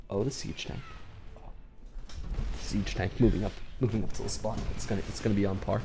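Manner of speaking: hushed golf commentator voice; soft, hushed golf broadcast. Acted. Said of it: "stop talking in the golf commentator voice".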